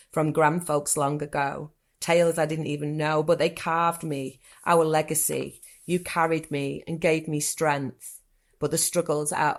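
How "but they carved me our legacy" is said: usual speaking voice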